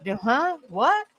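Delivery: mock puzzled question, rising high on "what"; puzzled, pitch climbing at the end; baffled reaction. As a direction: act out confused fans going "huh?". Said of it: "Rebel fam in here will be like".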